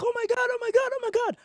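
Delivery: very high frantic wail; acted panic. Running away from six approaching lions, acted panic. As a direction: wail it over and over in terror.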